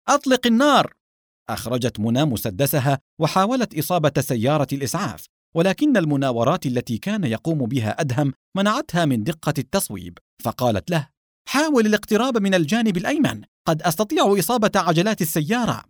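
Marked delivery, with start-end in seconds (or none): 0.1-0.9 high, urgent cry; shouted command. A narrator's reading. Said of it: "وصاح". 11.5-15.8 higher-pitched, urgent suggestion; urgent plea. A narrator's reading. Urgent advice amid a car chase.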